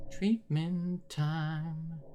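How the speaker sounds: eerie slow singsong menace; creepy asylum voice. Acted. Repeats phrase then spooks it.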